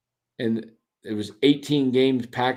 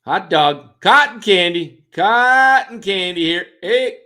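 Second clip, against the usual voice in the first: higher, loud hawker cry; stadium vendor hawking; 6 semitones higher, 7 dB louder, slower than usual. Retold or imitated. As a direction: call it out across the stands. Imitating ballpark vendors.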